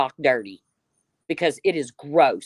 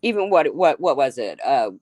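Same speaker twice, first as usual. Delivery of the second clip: ordinary talk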